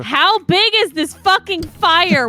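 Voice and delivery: high, drawn-out frantic outburst; frantic demand. Retold or imitated. Woman retelling her call about the fire, frantic. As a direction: stretch it out, high and incredulous.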